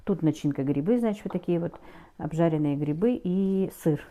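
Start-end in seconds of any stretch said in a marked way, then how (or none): none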